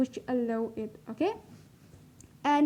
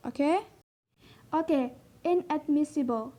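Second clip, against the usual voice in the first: ordinary talk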